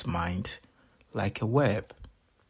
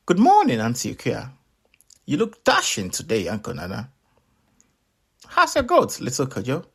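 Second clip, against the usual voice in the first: tiny melon voice; 2 semitones higher, faster than usual. Acted. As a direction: chirp politely like a talking melon. Melon greets townspeople.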